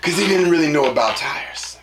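normal talking voice

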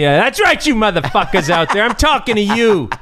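high-pitched, hammed-up heckling; mock screaming rant. Skier calling out reckless snowboarders, mock screaming. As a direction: call them out high and indignant. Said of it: "constantly screaming at people".

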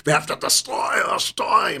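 put-on German accent. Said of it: "You have to picture that with a German accent".

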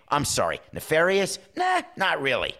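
a lightly voiced baffled player, high on "nefarious?"; puzzled player. Ballplayer puzzled by the official's question, lightly mock baffled. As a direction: play it confused by the big word, then shrug it off.

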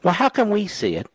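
normal talking voice

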